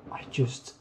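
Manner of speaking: unvoiced hushed whisper on "I just". Real, not acted. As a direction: drop to a breathy secret whisper. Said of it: "I don't know why I'm whispering".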